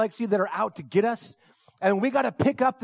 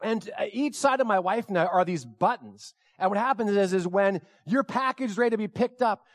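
ordinary delivery

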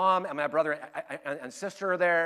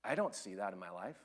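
much lower and softer, sheepish disbelief; doubting believer; 10 semitones lower, 11 dB quieter than usual. Acted. Believer doubting God's praise, low and quiet. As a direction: shrink into it, unconvinced. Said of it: "and you say".

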